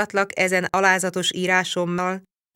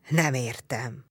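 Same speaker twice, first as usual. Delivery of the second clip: low, soft grumbling growl; 3 semitones lower, 4 dB quieter, slower than usual. A narrator's reading. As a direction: growl it under the breath. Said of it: "mordult meg Csát".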